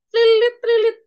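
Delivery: high, loud trilling ringtone; trilling up high like a telephone; imitated phone ring. Shown as a demonstration. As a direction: mimic a phone ringing with your voice. Imitates phone ringing in the video.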